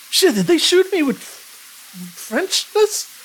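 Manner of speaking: high, slow, mock alarm. Player fearing a French curse, high mock alarm. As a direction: gasp it out in mock panic.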